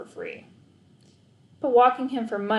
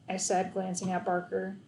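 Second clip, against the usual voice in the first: usual speaking voice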